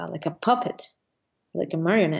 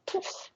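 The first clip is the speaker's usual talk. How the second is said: unvoiced puff of air; soft poof noise; 13 dB quieter than usual. Pilot showing the glider blowing up, soft airy poof. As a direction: imitate a sudden collapse with a soft puff.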